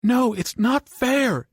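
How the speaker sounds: high, loud, slow wailing protest; despairing howl. Acted. Johnny howls at the unfairness, high and loud. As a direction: howl it out, desperate.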